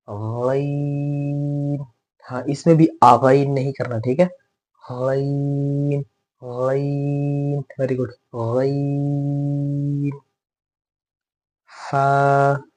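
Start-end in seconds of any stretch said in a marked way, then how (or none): 0.1-1.9 "गैन" drawn out slowly with a gargling throat sound; gargled ghain. Shown as a demonstration. Teacher showing the letter like gargling. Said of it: "गरारे की तरह". 4.8-10.1 "गैन" drawn out slowly with a gargling throat sound; gargled ghain. Shown as a demonstration. Teacher showing the letter like gargling. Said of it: "गरारे की तरह".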